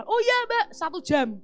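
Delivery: casual boatman. Retold or imitated. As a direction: toss it off like a cheerful boatman. Boatman answers, voiced in story. (suara tukang perahu yang santai, lebih tinggi)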